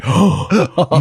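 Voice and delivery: a loud, high mock gasp; shocked gasp. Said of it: "and I was like".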